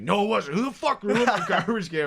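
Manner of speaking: high, loud indignant mock fury; furious denial. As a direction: insist he's innocent, outraged. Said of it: "Like, just furious".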